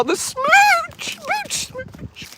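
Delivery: very high, squeaky squeal fading to a breath; a tiny high-pitched kiss noise, voice cracking into air; squeaky smooch squeal. Pretend. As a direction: squeak it like a kissing cartoon.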